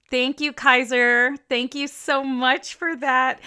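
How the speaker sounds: slow, loud mock gratitude; drawn-out ironic thanks; sarcastic thanks. Real, not acted. Sarcastic thanks to old classmate.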